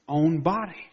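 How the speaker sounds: normal talking voice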